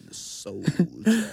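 slow, loud soul DJ drawl; soul DJ announce. Pretend. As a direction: stretch it like a soul radio host. Announcing next episode title.

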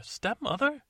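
very high squeaky question; high comic gasp; prince's high question. Acted. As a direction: squeak it out in disbelief.

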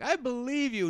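very high, melodramatic voice; mock heartfelt declaration. Host as heroine finding Santa, high mock-dramatic declaration.

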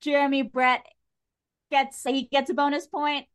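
ordinary talk, nothing marked

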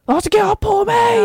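high shrieking wail at her clothes, slow; mock morning shriek. Retelling yelling at her clothes in a stressed morning. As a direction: shriek it at the wardrobe. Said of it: "som bara skriker på morgonen".

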